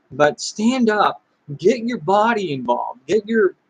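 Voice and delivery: normal talking voice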